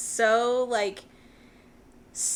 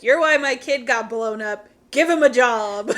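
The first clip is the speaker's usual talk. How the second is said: raised voice, acting out a scolding; retold yelling; 8 dB louder than usual. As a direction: imitate an indignant yell, louder than your chat. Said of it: "straight up yelling at him".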